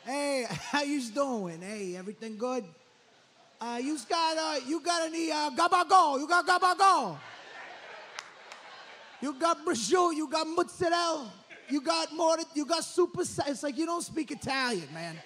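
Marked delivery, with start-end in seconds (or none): 0.0-2.6 slow, drawn-out mock Jersey greeting; mock Italian-American guy. Cousin at the deli, greeting in a hammed-up Jersey Italian accent. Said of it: "overpronounces the Italian deli meats". 3.6-13.4 higher and louder, deli meats in exaggerated Italian-American; loud overpronounced Italian. Cousin ordering at Subway, overpronouncing Italian meats loudly. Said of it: "pronouncing deli meats really loud".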